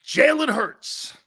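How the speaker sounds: high, drawn-out announcer call of the name; announcer reveal. Acted. Host unveiling the third MVP candidate, drawn-out announcer call. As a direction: call Jalen Hurts like a big reveal.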